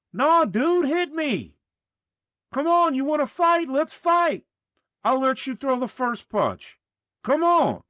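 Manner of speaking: high loud barking challenge; agitated bark. A narrator's reading. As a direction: bark it at him, fed up. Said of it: "agitated bark".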